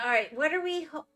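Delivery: normal talking voice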